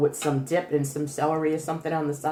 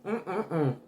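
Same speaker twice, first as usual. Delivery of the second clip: laughing yum; 4 dB quieter than usual. Real, not acted. Tasting food, laughs with pleasure.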